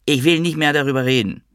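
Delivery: high-pitched protest, falling at the end; upset refusal to discuss it; refusing. A narrator's reading. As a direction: stress on "will", pitched high then falling.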